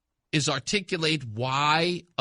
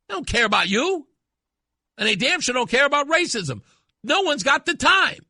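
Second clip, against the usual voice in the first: heated rant; 9 semitones higher, 5 dB louder than usual. Real, not acted.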